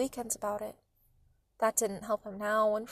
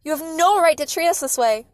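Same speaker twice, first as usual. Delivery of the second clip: loud, higher-pitched outrage; forceful raised-voice objection; yelling; 5 semitones higher, 10 dB louder than usual. A narrator's reading. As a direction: yell as an indignant mother. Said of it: "Mom yelled".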